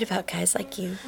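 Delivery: hushed, wary whisper. Acted. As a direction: whisper it warily. Wary aside to Steve.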